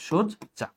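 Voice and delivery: soft, slow "সজ্জা" as shod, pause, ja; closed o, split. Shown as a demonstration. Teacher checking students follow, soft "সজ্জা" split shod - ja. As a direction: say "সজ্জা" softly in two parts with rounded o. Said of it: "ও দিয়ে উচ্চারণ করেছি".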